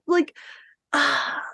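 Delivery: ordinary delivery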